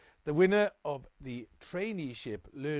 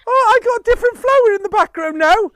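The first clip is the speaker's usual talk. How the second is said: screaming with joy, much higher and louder; overjoyed disbelieving screams; dawning joy; 17 dB louder, faster than usual. Real, not acted. Winner hears she has won.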